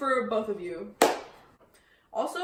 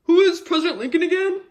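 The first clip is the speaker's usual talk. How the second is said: loud, raised-pitch taunting question; loud heckling question; 10 dB louder, faster than usual. Acted. Student calls a dumb question to the teacher, loud heckling. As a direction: call it out loudly across the classroom.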